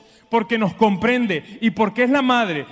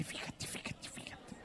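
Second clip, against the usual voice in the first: whispered nagging; 28 dB quieter than usual. Retold or imitated. Madre insistiendo al hijo.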